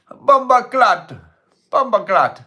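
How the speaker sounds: high, loud Jamaican exclamation, hammed up; mock Jamaican accent. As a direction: shout it like a cartoon Jamaican. Playing on the Jamaican accent bit.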